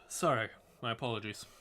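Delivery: ordinary talk, nothing marked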